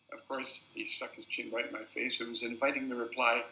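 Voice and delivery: ordinary delivery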